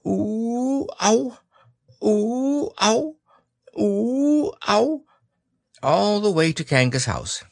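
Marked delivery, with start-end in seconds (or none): 0.1-5.0 high bouncing oohs and ows. A narrator's reading. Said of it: "and he was saying".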